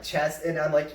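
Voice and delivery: ordinary talk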